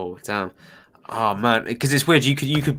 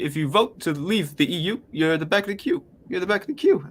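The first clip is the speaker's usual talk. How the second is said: higher-pitched imitation of a politician's accent; Obama impression; 6 semitones higher, 4 dB quieter than usual. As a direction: mimic the American president, pitched up. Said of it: "You did his accent?".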